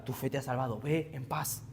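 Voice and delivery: very soft, hushed blessing; tender voice of Christ. Acted. Christ tells the forgiven woman, softly and tenderly. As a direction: speak it gently like a blessing. Voicing Christ's gentle words.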